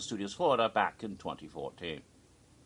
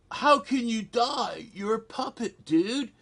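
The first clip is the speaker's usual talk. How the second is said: high, loud disbelief; hammed-up scoffing question; mock incredulous; 11 semitones higher, 4 dB louder than usual. Onlooker challenging a dying puppet, high mock incredulity.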